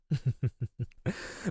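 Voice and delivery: high, stuttering villain chuckle; menacing chuckle. Acted. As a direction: chuckle darkly at your helpless prey. Predator amused by its captive.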